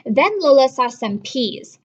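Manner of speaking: put-on lively read-aloud to children; storybook reading. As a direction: read it like a picture book to kids. Teacher reads a picture book.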